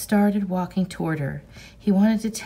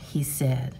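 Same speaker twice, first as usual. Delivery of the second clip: ordinary delivery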